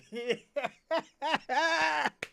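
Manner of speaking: high, loud burst of laughter. Real, not acted. Cracking up at his own teasing of Kwame, loud laughter.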